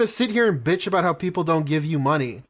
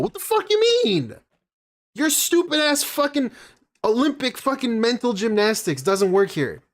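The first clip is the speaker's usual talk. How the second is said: much higher, outraged yelling; furious rant; 8 semitones higher than usual. Real, not acted. Streamer rages at a rival's analogy, high and outraged. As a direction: blow up in disbelief at a stupid argument.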